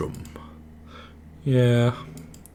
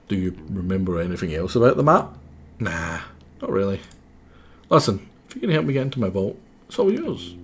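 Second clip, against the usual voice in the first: ordinary talk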